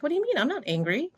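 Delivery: retold puzzled reply, jumping high at the start; replaying their own confused comeback; confused protest. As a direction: act out a baffled, high-pitched objection. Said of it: "I was so confused at first".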